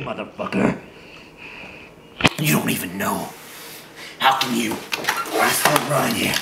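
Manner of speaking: usual speaking voice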